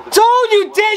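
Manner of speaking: high, loud shouted threat; shouted warning. Real, not acted. Fan yelling at VAR, a high, loud warning. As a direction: yell the warning at the screen.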